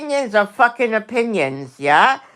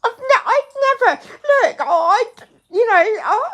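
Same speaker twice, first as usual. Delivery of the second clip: exasperated laugh. Real, not acted.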